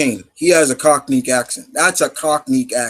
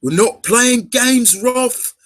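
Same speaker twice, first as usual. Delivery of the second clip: put-on high, drawn-out Cockney accent; slow Cockney call, pitched way up; mock Cockney game character; Frye brother impression; 11 semitones higher, much slower than usual. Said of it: "the brother".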